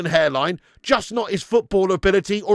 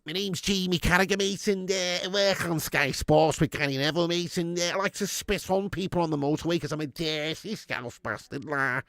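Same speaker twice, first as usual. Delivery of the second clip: Jamie impression; 2 semitones lower, 6 dB quieter than usual. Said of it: "Jamie impression".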